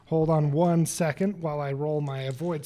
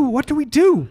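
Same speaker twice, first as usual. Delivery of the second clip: put-on high, loud panicked old woman; grandma in a panic; 10 semitones higher, 7 dB louder than usual. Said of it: "panicked grandma voice".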